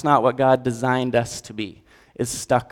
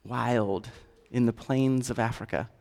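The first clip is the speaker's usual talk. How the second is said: tender God voice; 3 semitones lower, 12 dB quieter than usual. Acted. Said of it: "God looks at you and says".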